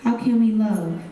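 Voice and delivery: poem delivered with building intensity; spoken word poem. Acted. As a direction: perform it like a slam poem to a crowd.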